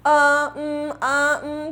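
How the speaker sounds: drawn-out "uh, umm" fillers; mock stammering hums; imitated hesitation. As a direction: stall like someone lost for words. Imitates a hesitant English speaker.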